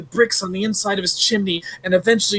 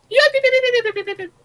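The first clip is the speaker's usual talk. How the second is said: yelping dog; much faster than usual. Acted.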